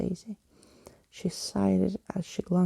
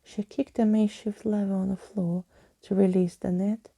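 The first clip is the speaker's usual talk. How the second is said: ordinary delivery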